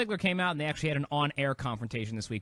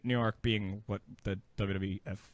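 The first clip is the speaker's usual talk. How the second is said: lower, softer explanatory aside; imitated quiet aside; 6 semitones lower, 5 dB quieter than usual. Wrestling host quietly explains a term to his co-host, retold aside. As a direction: drop the voice as if quietly filling someone in. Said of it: "quietly said to Renee".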